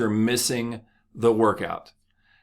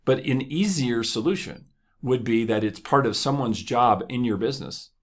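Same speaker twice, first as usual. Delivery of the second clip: ordinary talk, nothing marked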